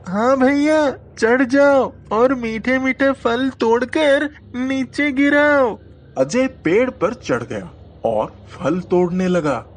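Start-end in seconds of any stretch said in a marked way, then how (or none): 0.1-5.8 put-on high, louder eager child; eager young boy. A narrator's reading. Sameer urging his brother to drop sweet fruit, high and eager. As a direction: egg your brother on up the tree.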